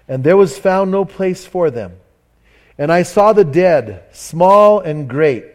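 slow, weighty Bible reading; solemn scripture reading. Acted. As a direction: read each phrase like a pronouncement. Reads Revelation aloud.

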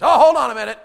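loud, high imitation of dad; sudden mock bellow; dad's bluff holler. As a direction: holler it like a no-nonsense father. Acting out his dad's reaction.

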